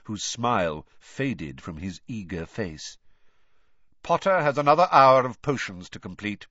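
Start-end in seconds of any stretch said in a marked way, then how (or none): 4.0-6.5 cold, louder refusal; stern teacher, raised and unyielding. A narrator's reading. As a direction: shut the request down icily. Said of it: "said Snape coldly".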